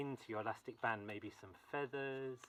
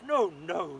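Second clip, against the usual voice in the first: loud, firm no; a father forbidding his daughter; king's loud refusal; 4 semitones higher, 12 dB louder than usual. Acted. King refuses to risk his daughter, loud refusal. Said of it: "said the king".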